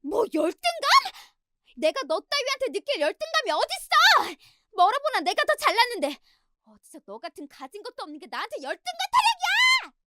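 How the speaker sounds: very high-pitched screeching outburst; enraged yelling. Acted. Sister-in-law, stung, yelling at her elder in public, enraged. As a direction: lose control and shriek in fury. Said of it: "소리를 버럭 지르더군요".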